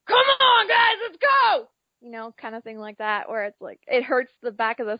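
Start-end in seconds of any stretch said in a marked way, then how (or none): 0.1-1.7 full-throated stadium cheer, drawn out; man-voice game yell. Shown as a demonstration. Fan showing their loud game-day voice, shouted cheer. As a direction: holler it high and long at the team. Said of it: "I got my man voice going".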